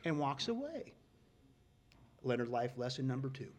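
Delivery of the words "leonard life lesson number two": ordinary talk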